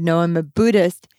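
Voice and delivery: normal talking voice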